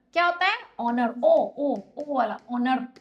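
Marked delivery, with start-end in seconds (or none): 0.8-1.1 ऑनर said with a silent h, opening on aw; correct pronunciation. Shown as a demonstration. Teacher repeating the right form to students, ऑनर without h. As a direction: give ऑनर as aw-ner with no h sound. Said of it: "इसका कुछ ऐसा pronunciation होता है".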